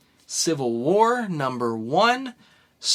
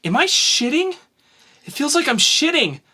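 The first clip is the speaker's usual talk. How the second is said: high, strained voice of a woman giving birth; [name] in labor; 12 semitones higher, 5 dB louder than usual. Acted. [name] asks Marco during childbirth, high and strained. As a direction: push the words out high mid-labor. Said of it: "Hazel narrating, [name] and Marco doing the characters".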